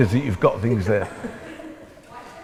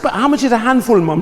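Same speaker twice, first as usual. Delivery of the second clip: high, insistent mock child's question; pestering child; 8 semitones higher, 3 dB louder than usual. Son asking mum what a handful is, pestering. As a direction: play yourself pestering mum in a raised pitch. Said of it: "I said".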